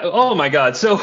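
normal talking voice

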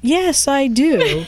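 slow, high, overly assured reply; mock confident yes. To the executive, slow, high mock-confident reply. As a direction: draw it out like a proud teacher. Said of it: "And I'm like".

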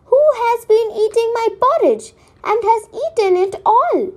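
a little bear complaining in a high voice; Baby Bear grumbling. A narrator's reading. Baby Bear finds his porridge all gone, high complaining voice. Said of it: "Baby Bear grumbled".

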